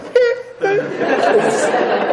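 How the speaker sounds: very high, squeaky imitated sobs; exaggerated high whimper, played for laughs; mock crying. Man answers girlfriend in the new car, mock crying. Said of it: "I just start crying".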